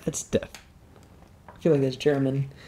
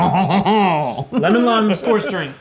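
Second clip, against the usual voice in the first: announcer voice; 4 semitones higher, 6 dB louder, much slower than usual. Pretend. Slow dramatic translation.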